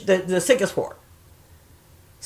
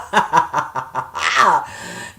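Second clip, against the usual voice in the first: real laughter; 3 semitones lower than usual. Real, not acted. Woman cracking up at her own taunt, cackling. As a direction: crack up helplessly at your own joke.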